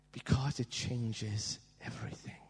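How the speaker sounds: hushed, intimate whisper. Real, not acted. Preacher confiding to the congregation, whispered. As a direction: lean in and breathe it.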